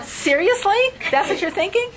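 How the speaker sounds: can't believe what she's hearing; incredulous. Retold or imitated. Reacting to son-in-law's rent remark.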